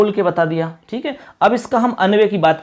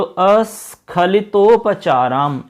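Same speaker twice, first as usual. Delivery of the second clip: slowly spelling out the long word; slow syllable by syllable; much slower than usual. Shown as a demonstration. Teacher to students, spelling the compound slowly. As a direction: draw अस्खलितोपचाराम out syllable by syllable. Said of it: "ऐसे आपको इसमें टुकड़ा करना होगा".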